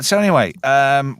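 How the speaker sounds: ordinary talk, nothing marked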